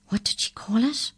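high, hushed whispered aside. A narrator's reading. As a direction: whisper the question to your partner. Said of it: "whispered Molly".

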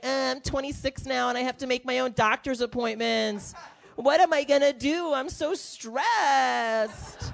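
high, whiny mock complaint; complaining millennial. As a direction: whine helplessly, stretching out the last word. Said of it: "millennials complain 'cause they're like".

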